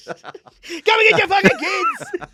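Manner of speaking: high, loud, drawn-out holler; mock desperate yell. Worn-out parent calling the ex, mock desperate yell. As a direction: yell it slowly through a laugh. Acted plea to ex for relief.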